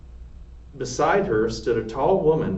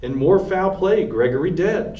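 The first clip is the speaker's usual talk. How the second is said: raised, higher alarmed cry; shouting; 5 semitones higher, 4 dB louder than usual. A narrator's reading. Cook shouting news of Gregory's death to Louise. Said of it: "shouted Cook".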